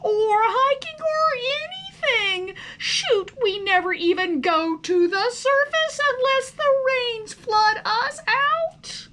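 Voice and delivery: high, loud, whining tantrum of a fed-up little worm; whiny worm outburst. Acted. Son complains to his parents at dinner, loud whiny tantrum. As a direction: wail it like a sulking child who hates everything. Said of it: "exhausted by his outburst".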